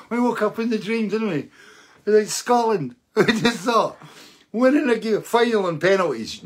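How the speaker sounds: high, loud and thrilled, voice up in the air; can't believe the win, buzzing; excited. Real, not acted. Telling his viewers Scotland won, excitedly high and loud.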